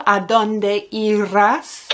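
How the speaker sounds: "¿A dónde irás?" asked slowly, rising on "A"; slow clear model. Shown as a demonstration. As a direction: spell the question out slowly for learners. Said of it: "Where will you go?".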